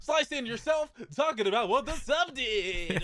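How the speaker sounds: high, playful theatrical command; calling out. Pretend. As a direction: order the absent editor about with mock urgency. Keeps hailing the absent editor.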